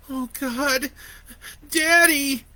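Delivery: put-on high crying child's voice; sobbing. A narrator's reading. Boy crying over his dead father, sobbing. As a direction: sob out the words in despair. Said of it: "he sobbed".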